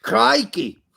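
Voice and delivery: bad Australian accent. Mocking his cohost's slang, a bad Australian Crikey!. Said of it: "That was the worst, uh, accent I've ever heard".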